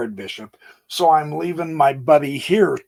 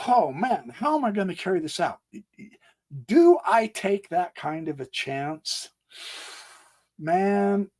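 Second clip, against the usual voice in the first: normal talking voice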